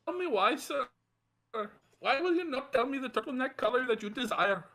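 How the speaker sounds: mock Indian accent. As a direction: drag the bit on in a fake Indian accent. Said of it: "like a Family Guy joke".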